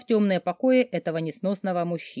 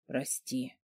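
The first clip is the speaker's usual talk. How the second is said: much softer, lower breath of a whisper; whispered apology; 6 semitones lower, 12 dB quieter than usual. Acted. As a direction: whisper it without opening eyes. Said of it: "прошептал вдруг Дмитрий".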